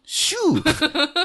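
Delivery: a high, very slow hiss, copying the cat hiss; drawn-out high teasing hiss; mock cat hiss. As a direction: echo the hiss back, teasing. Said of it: "なんか飛んでったよ".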